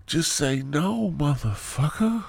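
high, slow, hammed-up after-school-special voice; mock PSA dad. Comedic dad lecturing his kids, high and slow. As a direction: drag out the slogan like a preachy PSA.

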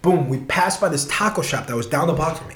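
ordinary talk

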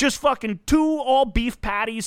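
slow, high, spelled-out rant; slow emphatic rant. Real, not acted. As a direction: drag each item out for emphasis. Rant about apps.

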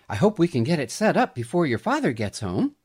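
quick, playful mother's voice; playful mother hurrying before dad gets home; with a twinkle. A narrator's reading. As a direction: tease her gently, quickly. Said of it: "she added with a twinkle in her eye".

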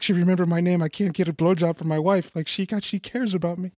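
high, whiny lonely man; pathetic lonely man. Retold or imitated. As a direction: play the sad lonely client. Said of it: "It's like".

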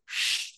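a quiet, breathy shh; soft shush. Retold or imitated. Star player hushing a fan so people won't notice him, a soft shush. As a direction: hush him so nobody notices.

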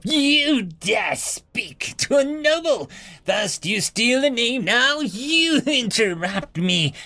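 high, very slow outraged scream; screaming noble. Acted. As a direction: rage at the insult. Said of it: "The noble screamed".

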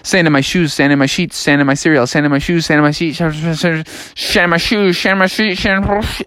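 rapid tongue twister falling into slurred gibberish. Acted. Froggy trying a tongue twister, racing and garbling it. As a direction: speed up until the words tangle. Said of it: "say it faster and faster".

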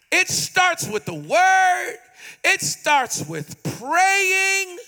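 slow, emphatic preaching with stretched words; forceful preaching. Real, not acted. Preacher urging congregation to talk to God, forceful preaching. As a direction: drive each phrase home, slowly and forcefully.

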